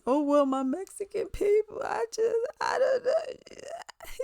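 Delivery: high, wailing fake sobs; mock crying. Mimicking a singer crying for her people, mock sobbing. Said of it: "film herself fake crying".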